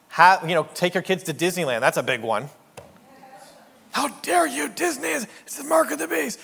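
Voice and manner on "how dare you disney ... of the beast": much higher, hammed-up scandalized voice; mock outraged believer. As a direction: parody a pearl-clutching churchgoer.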